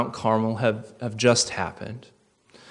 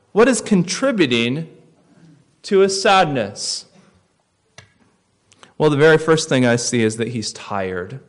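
ordinary delivery

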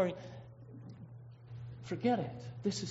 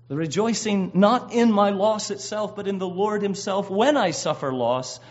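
fervent preaching; 3 semitones higher, 8 dB louder than usual. Acted. Preaching at full force.

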